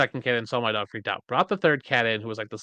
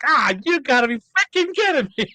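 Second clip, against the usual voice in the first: high, loud hammed-up outburst; mock exasperation; 14 semitones higher, 5 dB louder than usual. Husband retells learning why his wife cried, hammed-up exasperated yell. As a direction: cry out in comic disbelief. Retold husband's reaction to pregnancy.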